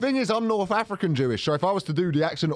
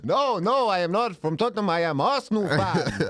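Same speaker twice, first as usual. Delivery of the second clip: exaggerated Eastern European-style accent; comic old-man accent. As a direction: protest in a mock thick foreign accent. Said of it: "I'll, I'll do the accent".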